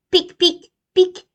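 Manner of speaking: very high, loud squeaky bee voices; stinging bees. Acted. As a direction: bees jabbing the bear.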